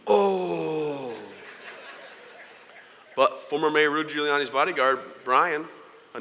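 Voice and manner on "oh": long, sinking "oh" of a crowd; imitated crowd groan. Crowd watching him trip on the cord, imitated groan. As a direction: mimic a dismayed crowd's drawn-out groan. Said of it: "twenty thousand people go from".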